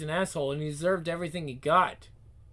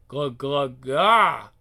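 gulping then refreshed sigh; 10 dB louder, much slower than usual. Acted. Drinking the soda.

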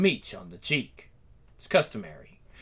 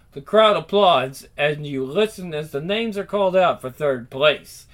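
ordinary talk

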